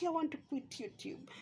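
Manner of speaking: small, high, soft fake-weepy voice; mock tearful. Vlogger mimicking Agi threatening to quit, mock tearful. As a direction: imitate a pitiful announcement. Mocking a tearful quitting announcement.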